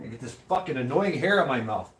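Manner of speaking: ordinary delivery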